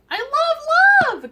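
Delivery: high, loud, stretched-out cheer; excited cheer. Real, not acted. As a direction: sing it out with joy. Toast cheer.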